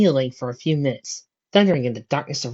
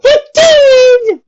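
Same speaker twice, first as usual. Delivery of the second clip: high, loud crying wail; 14 dB louder than usual. A narrator's reading. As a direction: wail in theatrical despair. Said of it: "Rarity wailed".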